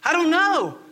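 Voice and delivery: hammed-up panic, high-pitched; distressed churchgoer. Churchgoer comes to pastor doubting salvation, mock distressed. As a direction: plead in mock desperation, pitch climbing to the end. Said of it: "then you come to me and say".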